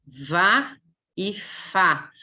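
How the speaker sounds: "vá e fá" slow, lips against teeth, buzzing the v; phonics demo. Shown as a demonstration. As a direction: stretch "vá e fá" out slowly. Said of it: "Se eu digo". ("vá e fá" bem devagar, fricativo)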